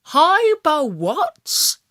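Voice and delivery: high, loud and slow disbelief; baffled hare, drawn out; puzzled question. A narrator's reading. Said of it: "I asked".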